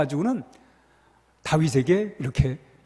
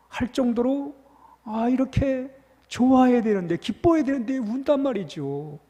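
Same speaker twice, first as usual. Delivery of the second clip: fervent preaching; 7 semitones higher than usual. Acted. Pastor urging the congregation to see David's tears, fervent preaching. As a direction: drive the point home with rising passion.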